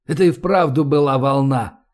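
ordinary delivery, nothing marked

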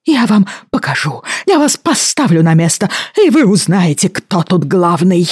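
put-on high, outraged old man, slowing; furious pompous apothecary; indignant old man voice. Apothecary threatens, leaving.

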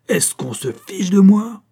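high, loud and drawn out; outraged yell. A narrator's reading. Director feeling mocked by his staff, yelling. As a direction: bellow it slowly in indignation. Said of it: "hurla-t-il à la cantonade".

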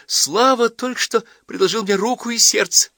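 high, bright gushing announcement; girl tells friends of proposal, excited. Acted. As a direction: burst out with the big news. Varya gushing news.

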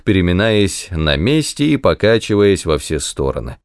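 plain reading voice

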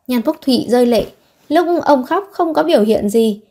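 ordinary delivery, nothing marked

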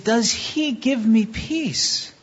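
ordinary talk, nothing marked

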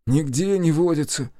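high, annoyed reply; vexed. A narrator's reading. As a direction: answer with open annoyance. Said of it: "с досадой ответил". (с досадой)

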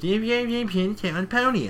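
high, impatient parroting; mocking dismissal. Gilda brushing off the game's instructions, mocking.

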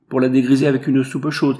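called out loud and generous; shouted. A narrator's reading. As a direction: fling the line out over the street. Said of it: "crie-t-il". (crié fort)

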